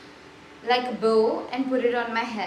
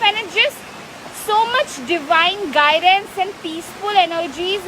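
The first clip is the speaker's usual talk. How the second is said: louder, higher sales pitch; 5 semitones higher, 6 dB louder than usual. Acted. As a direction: sell the magic with gusto. Pitches paintings for sale.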